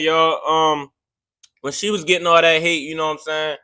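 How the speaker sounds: ordinary delivery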